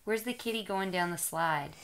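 ordinary talk, nothing marked

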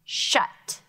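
"Shut." said crisp and breathy; sounded word. Shown as a demonstration. Teacher models the next word for students. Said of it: "That is a short vowel".

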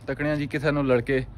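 usual speaking voice

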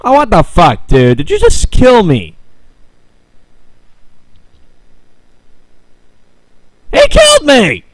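high, loud outraged yelling; real startled outrage, shouted high; shocked shout. Real, not acted. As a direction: yell in disbelief at the screen. Player killed suddenly.

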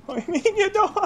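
high, giggling mock plea; can barely say it for laughing. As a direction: laugh it out to the ghost. Repeated through laughter.

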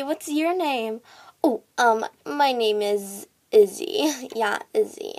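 usual speaking voice

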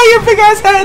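high-pitched laugh running into a jeer; laughing taunt. Real, not acted. Player mocking the rival they shot, laughing taunt. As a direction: taunt them through laughter.

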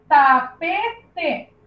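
"Tapete" split into ta, pe, te; syllable by syllable. Shown as a demonstration. Teacher building a three-syllable word, "Tapete" syllable by syllable. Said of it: "eram três sílabas".

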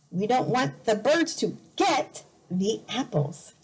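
usual speaking voice

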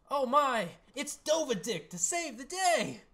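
high, loud, slow cartoon hero; heroic hero voice. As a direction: announce yourself like a hammy savior.

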